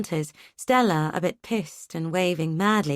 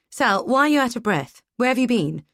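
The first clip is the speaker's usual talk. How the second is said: sharp, irritated and louder; 5 semitones higher, 5 dB louder than usual. A narrator's reading. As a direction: snap with impatience. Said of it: "sharp edge of irritation in your voice".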